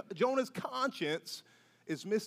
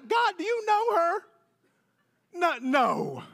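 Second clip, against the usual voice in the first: jealous church member; 7 semitones higher than usual. Retold or imitated. Congregant complains about friend's blessings.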